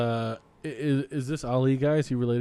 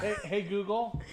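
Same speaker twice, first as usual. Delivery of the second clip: calling voice assistant; 8 semitones higher, 7 dB quieter, slower than usual. Real, not acted.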